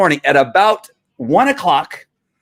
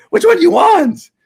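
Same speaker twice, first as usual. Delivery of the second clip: much higher and louder, demanding an answer; exasperated yell; 14 semitones higher, 4 dB louder than usual. Real, not acted. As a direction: throw the question back at the critics. Repeats the question, then yells it.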